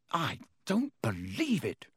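hissed in outrage. A narrator's reading. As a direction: hiss it through disbelief. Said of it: "Wood hissed in outrage".

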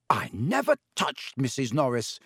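usual speaking voice

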